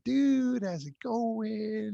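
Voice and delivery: high, slow, drawn-out surfer dude greeting; laid-back surfer. Retold or imitated. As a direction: play the relaxed California beach guy, stretched out and easy. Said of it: "really relaxed".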